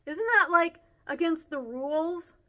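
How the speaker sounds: high, loud tattletale question; obnoxious kid. A narrator's reading. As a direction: challenge the teacher like a rule-minding pupil. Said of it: "He's the obnoxious kid".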